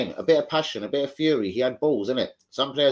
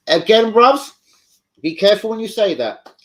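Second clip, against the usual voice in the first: much louder and higher, urgent caution to the chat; raised warning; 9 semitones higher, 10 dB louder than usual. Real, not acted. Streamer loudly warns viewers to be careful saying that, raised urgent voice. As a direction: call out a sharp warning to the bruvs.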